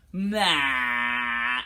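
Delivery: low loud tongue-out grunt. Shown as a demonstration. Teacher showing the big face, loud low grunt. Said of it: "a big face with our tongue sticking right out".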